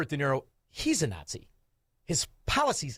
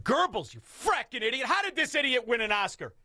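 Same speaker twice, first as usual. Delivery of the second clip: mock exasperation; 8 semitones higher than usual. Mock director berating the actor.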